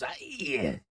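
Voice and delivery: slow, put-on halfling voice; lightly voiced tipsy halfling; halfling NPC voice. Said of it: "He goes".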